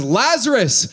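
a loud, high call of the name; loud cry. Acted. Jesus calling Lazarus out of the tomb, loud high cry. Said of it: "he cried with a loud voice".

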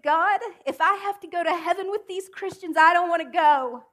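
high, loud, desperate cry to God; retold anguished scream. In her closet, crying out to God: loud anguished scream. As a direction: yell it up at heaven in despair. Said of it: "and cry and scream and say".

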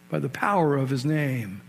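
ordinary talk, nothing marked

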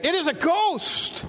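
high-pitched mock cry of fear; frightened cry. Frightened disciples seeing him walk on the sea, crying out in fear. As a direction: cry out as if terrified, acted. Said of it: "they cried out for fear".

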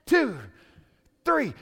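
loud, high ringside count of one, two, three; referee count. Retold or imitated. Troops on the hillside counting Goliath out, loud and high. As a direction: count the fallen giant out like a fight referee. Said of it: "and they're like".

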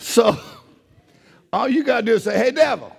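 high, fervent pulpit proclamation; impassioned, driving sermon cadence; full-force preaching. Real, not acted. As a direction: preach it with rising fire, almost shouting.

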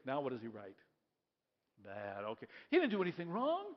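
ordinary talk

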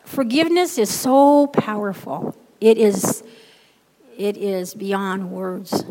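loud then slowing to a stretched finish; climactic declaration. Acted. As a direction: let it land, slow at the end.

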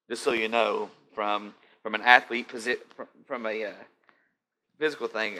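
usual speaking voice

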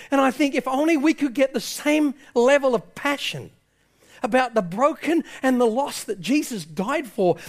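high, urgent sermon voice; impassioned preaching. Real, not acted. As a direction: exhort the congregation with fervour. Preaches with rising passion.

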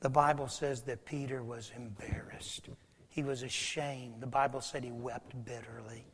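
soft, low, almost whispered; preacher telling of Peter's shame, hushed and low. Real, not acted. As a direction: drop to a hush for his shame.

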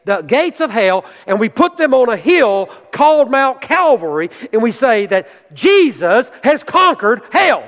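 high, forceful pulpit proclamation; fervent preaching. Acted. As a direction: preach it out with rising, punched emphasis.

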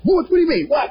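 high, defensive mock outburst; mock indignant. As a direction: play yourself getting caught and snapping back. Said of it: "if I get mad".

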